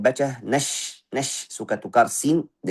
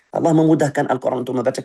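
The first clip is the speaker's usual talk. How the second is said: usual speaking voice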